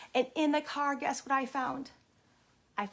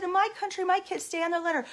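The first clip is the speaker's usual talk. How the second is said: raised, higher-pitched reprimand; stern scolding; 6 semitones higher, 4 dB louder, faster than usual. Retold or imitated. Visitor telling kids to stay on their letter, stern scolding. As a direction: lay down the rule, loud and indignant.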